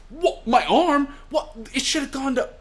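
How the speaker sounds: high-pitched mock panic; freaking out. Hawkins seeing his own arm hurt, mock freaking out. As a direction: yelp it in hammed-up disbelief. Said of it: "Hawkins is freaking out".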